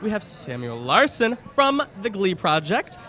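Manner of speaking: bubbly red carpet host intro; bright, excited welcome to viewers; gushing red carpet chatter. Acted. Host opens red carpet segment.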